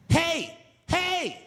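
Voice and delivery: high, loud hammed-up [name] shout; [name] impression. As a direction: bellow the catchphrase like a fan. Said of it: "I'm [name], bitch".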